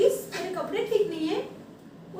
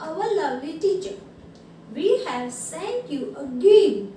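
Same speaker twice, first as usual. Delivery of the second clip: very slow, emphatic letter reading; drawn-out classroom read-aloud; 5 dB louder, much slower than usual. Real, not acted. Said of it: "now I will read this".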